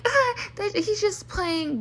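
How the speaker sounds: mocking dismissive voice. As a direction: drag it out like a careless mum brushing it off. Mothers excusing sons, mocked.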